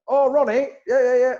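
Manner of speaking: put-on high, loud, cheery Essex accent. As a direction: greet him like a chirpy Essex man on the phone. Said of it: "Obviously in an Essex accent".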